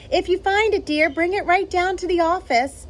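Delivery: calling out. Acted. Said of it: "He called to Missus Burbank".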